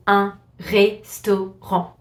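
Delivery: restaurant in French, syllable by syllable, no T; French silent T. Shown as a demonstration. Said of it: "we just don't pronounce the T".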